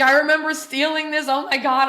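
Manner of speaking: can't believe it; amused shock; giddy disbelief. Retold or imitated. Retells thought while dusting.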